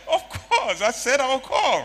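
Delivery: high, loud protesting answer; shouted reply. Retold or imitated. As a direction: yell back in your own defence.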